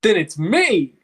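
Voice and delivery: eager anime hero shout; Pokemon protagonist. Real, not acted. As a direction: exclaim like a plucky cartoon hero. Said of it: "You said it like a fucking Pokemon protagonist".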